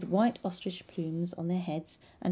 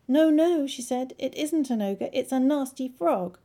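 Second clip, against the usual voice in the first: frightened princess; 6 semitones higher, 6 dB louder than usual. Acted. Frightened princess pleads with father.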